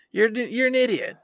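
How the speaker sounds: normal talking voice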